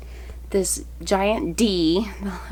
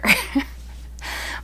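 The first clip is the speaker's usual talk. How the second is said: breathy laugh; 12 semitones higher, 3 dB quieter than usual. Real, not acted. Joke about children's father.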